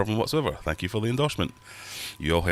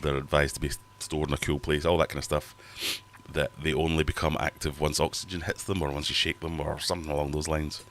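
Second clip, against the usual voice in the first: ordinary talk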